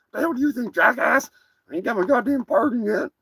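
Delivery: a cocky cartoon turkey boasting; turkey character.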